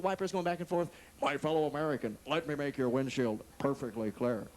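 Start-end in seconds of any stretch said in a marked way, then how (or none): 1.2-4.5 lower, slow presidential voice; Nixon impression. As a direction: say it like Nixon giving a speech. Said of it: "political persuasion of the car".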